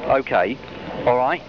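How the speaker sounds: normal talking voice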